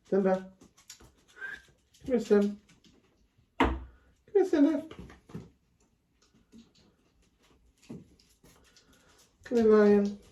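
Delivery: very high, sing-song call to the dog. Real, not acted. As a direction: call him over in a squeaky coo.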